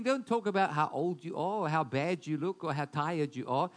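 ordinary talk, nothing marked

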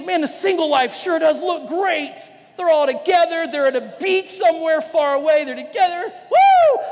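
high, lively envy ending in a loud whoop; mock envy of singles. Married man envying singles at the beach, whooping. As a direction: gush over the singles' life then let out a whoop.

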